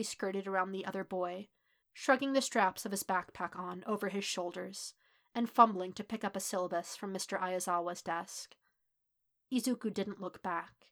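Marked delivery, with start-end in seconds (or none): none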